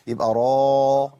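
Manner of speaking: را said slowly and loudly with a full heavy r; را drawn long with a thick emphatic r. Shown as a demonstration. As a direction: stretch را out, full-mouthed and heavy. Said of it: "الراء عندي مفخمة".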